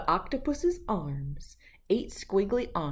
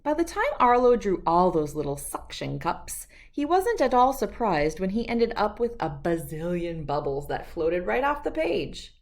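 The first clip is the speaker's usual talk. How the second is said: ordinary delivery, nothing marked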